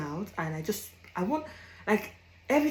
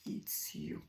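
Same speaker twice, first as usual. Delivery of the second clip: whisper; 17 dB quieter than usual. Acted. Repeated punchline dropped to whisper.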